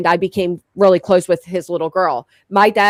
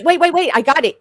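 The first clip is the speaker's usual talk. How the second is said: normal talking voice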